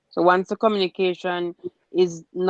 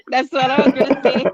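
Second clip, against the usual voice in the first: laughing; 6 semitones higher, 8 dB louder, faster than usual. Real, not acted. Laughing reply in group chat.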